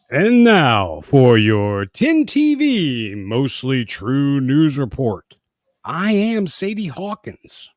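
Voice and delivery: slow, mock news anchor read; deliberate newsreader voice.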